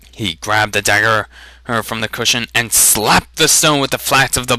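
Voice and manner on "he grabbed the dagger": usual speaking voice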